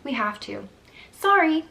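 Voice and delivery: retold nurse's careless "Sorry" as she walks off; mock nurse shrugging off the mother; curt brush-off. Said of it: "that's literally how she said it".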